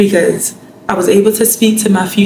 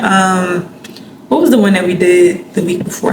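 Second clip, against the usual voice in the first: normal talking voice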